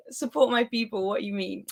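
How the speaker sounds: ordinary talk, nothing marked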